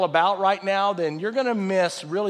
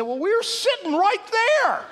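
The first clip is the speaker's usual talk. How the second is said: sheriff quote; 5 dB louder than usual. Retold or imitated. Sheriff quoted, high excited.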